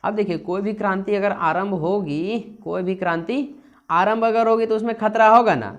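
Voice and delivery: normal talking voice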